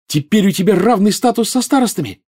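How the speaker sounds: put-on high-pitched, delighted girl; joyful exclamation. A narrator's reading. Girl congratulates her friend on becoming captain, joyful. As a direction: burst out with the happy news. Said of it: "радостно воскликнула Гермиона".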